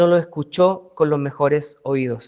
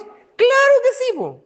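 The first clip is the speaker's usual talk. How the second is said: emphatic yes; 4 dB louder, slower than usual. Acted. Exclamación enfática.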